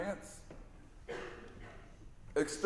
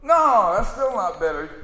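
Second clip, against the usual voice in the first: much higher and louder cry of no; a dim kid still feeling cheated; shouted whining protest; whiny sidekick; 6 semitones higher, 9 dB louder than usual. Retold or imitated. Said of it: "He goes".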